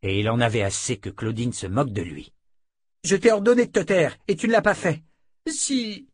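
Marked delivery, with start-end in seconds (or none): 3.0-5.0 high, raised angry scolding; réprimande furieuse. A narrator's reading. Furious attacker reprimands the captive. 5.5-6.1 very high, thin moan; gémissement. A narrator's reading. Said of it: "gémit Landérienne".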